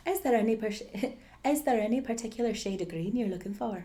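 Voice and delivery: fast, laughing copy of a bad Irish accent; imitated Irish accent. Actor copying co-host's silly Irish line, in an Irish accent. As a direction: repeat the line in mock Irish, giggling. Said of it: "the one phrase that I always use".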